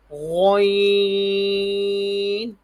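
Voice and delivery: غین starting on a rounded throat gho, held high; heavy ghain. Shown as a demonstration. Teacher models for pupils, heavy drawn-out غین. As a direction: puff the mouth and stretch غین thickly. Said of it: "منہ پھلا دینا ہے".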